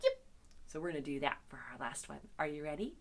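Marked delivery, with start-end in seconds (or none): 0.0-0.2 two tiny high-pitched yips; fox yip. Shown as a demonstration. Teacher shows friends how a fox sounds, fox yip. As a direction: yelp like a little fox. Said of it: "a fox makes a sound like this".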